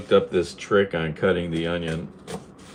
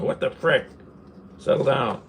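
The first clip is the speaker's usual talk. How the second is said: mock annoyed; 8 semitones higher than usual. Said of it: "I'd be going".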